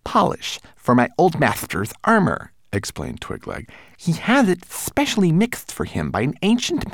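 high little manservant voice; fussy tiny homunculus. Acted. Twigleg explains.

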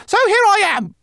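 squeaky resigned rat; high rat voice. Acted. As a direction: sigh it out, high. Lola resigned.

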